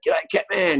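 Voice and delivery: high-pitched, contained mock holler; retold yell from a passer-by in the crowd. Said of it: "this stranger yells".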